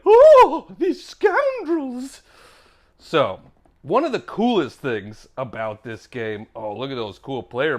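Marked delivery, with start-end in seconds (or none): 0.1-2.2 very high, drawn-out British gasp of disapproval; a buttoned-up English lady clutching her pearls; mock British lady's shocked sigh; prim British lady. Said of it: "buttoned up British lady would be like".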